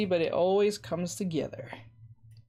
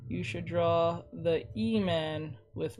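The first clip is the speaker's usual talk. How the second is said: usual speaking voice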